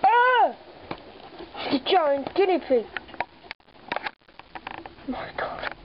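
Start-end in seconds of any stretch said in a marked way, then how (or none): 0.0-0.5 high shocked cry; sudden pretend fright; startled yelp. Spots giant guinea pig. 5.1-5.7 whispered. Pretend. Awed at giant guinea pig.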